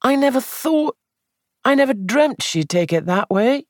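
fretful, guilty young man, voiced high; distressed young man. Sandford continues his lament.

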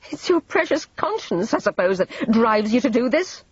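high-pitched sneer after an ugly laugh; short laugh, then mocking jibe. A narrator's reading. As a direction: sneer it with biting contempt. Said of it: "she sneered".

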